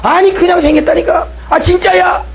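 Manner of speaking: very high-pitched insistent protest; exasperated mock insistence; heated arguing. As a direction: play someone desperate to be believed in a quarrel. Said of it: "막 따지는 거예요".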